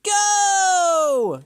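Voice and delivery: long, high, loud GO; shouted start. A narrator's reading. Animals send the racers off with a long high shout. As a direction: yell it, holding the vowel. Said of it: "shouted all the other animals".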